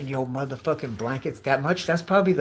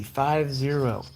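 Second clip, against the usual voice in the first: ordinary talk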